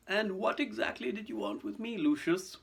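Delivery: put-on high, measured, courteous elderly voice; polite. Dumbledore questioning Lucius, coolly polite. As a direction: ask politely, with steel underneath. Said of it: "He spoke politely".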